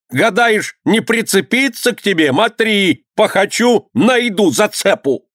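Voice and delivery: high-pitched threatening rant; angry squealing. A narrator's reading. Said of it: "завизжал воевода". (угрожающий крик высоким голосом)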